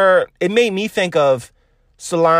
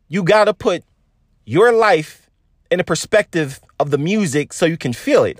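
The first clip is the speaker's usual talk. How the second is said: usual speaking voice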